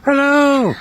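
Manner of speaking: high, loud hello to a pet bird; calling greeting. Real, not acted. Owner coaxing a parrot to say hello, calling out.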